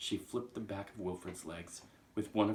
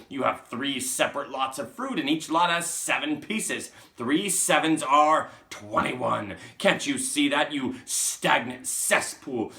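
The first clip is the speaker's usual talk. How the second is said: raised, full-volume tirade of insults; furious shouting; 6 semitones higher, 14 dB louder than usual. Acted. Trunchbull berating Wilfred about sevens, furious shouting. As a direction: berate the pupil, leaning hard on the insults. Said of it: "shouted the Trunchbull".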